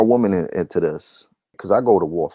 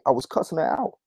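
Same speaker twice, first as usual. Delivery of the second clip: ordinary delivery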